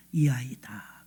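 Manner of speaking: low, very soft whisper; hushed inner voice. Acted. The Spirit telling Samuel who is chosen, hushed. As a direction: whisper it like a quiet revelation.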